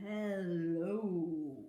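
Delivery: Hello dropped deep and low. Shown as a demonstration. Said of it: "as low as we can".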